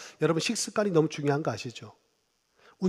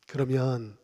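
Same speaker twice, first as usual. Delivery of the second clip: usual speaking voice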